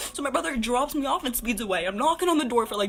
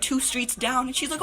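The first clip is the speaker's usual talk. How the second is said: ordinary talk, nothing marked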